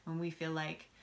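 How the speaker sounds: normal talking voice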